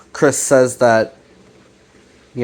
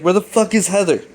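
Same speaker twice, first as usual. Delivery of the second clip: pitched well up, imitated urgent question; mock alarmed demand; 8 semitones higher, faster than usual. Alex asks Chris where Heather is, mock alarm. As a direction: retell it as an agitated demand.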